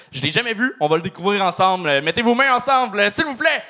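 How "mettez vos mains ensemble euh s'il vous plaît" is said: rising, high-pitched stage introduction; announcer's call-up. Real, not acted. Host welcoming the next comedian on stage, rising announcer shout. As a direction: build up to shouting the act's name.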